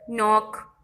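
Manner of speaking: नॉक said from n, k silent; teacher models नॉक for pupils, k unspoken. Shown as a demonstration. नॉक बिना के. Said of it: "के नॉक नहीं पढ़ेंगे".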